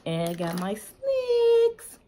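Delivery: high, slow, delighted reveal; playful showoff. Pretend. Vlogger proudly showing viewers her snacks, high and playful. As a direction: unveil the snacks like a treat.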